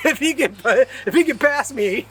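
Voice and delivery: high, chuckling through the words; laughing while talking. Real, not acted. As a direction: laugh it out, amused at yourself. Laughing at his own moth story.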